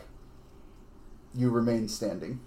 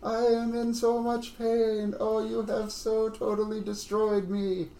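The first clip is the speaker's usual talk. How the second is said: melodramatic little skulls moaning in pain, way up high; wailing skull chorus; 11 semitones higher, slower than usual. Acted. GM hams up the skull chorus dying at the players' hands, high crying wail. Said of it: "this little chorus of, of all the, all these little skulls".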